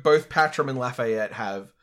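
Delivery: normal talking voice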